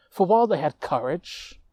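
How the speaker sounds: plain reading voice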